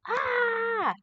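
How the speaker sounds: a sudden high, loud scream; teacher shows the class what a scream sounds like; demo scream. Shown as a demonstration. For a vocabulary lesson, teacher belts out a loud scream. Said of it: "you scream loudly, like".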